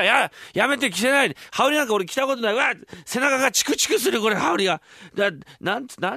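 high, flustered pleading; panicked protest. Acted. 羽織を無理やり着せられる金ちゃんの、慌てた悲鳴まじりの抗議. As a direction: squirm away from the robe. Kin resists the haori.

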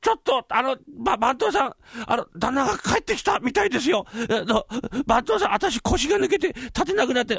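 high-pitched, stammering alarm; panicked servant. Acted. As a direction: stumble over the words in terror. Servant panics, legs give way.

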